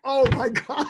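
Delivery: high, loud retold cry of dismay; dismayed outcry. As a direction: cry out in comic exasperation. Retold dismay at losing them.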